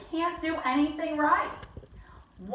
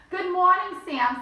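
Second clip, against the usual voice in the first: father greeting; 8 semitones higher, 6 dB louder than usual. Acted. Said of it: "said my father".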